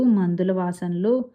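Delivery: usual speaking voice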